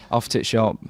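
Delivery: Yorkshire "off to the shop", run together as off t'shop; Yorkshire dialect. Shown as a demonstration. Yorkshire lad repeating his example, Yorkshire dropped words. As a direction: swallow "the" in a Yorkshire accent. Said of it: "If you're Yorkshire".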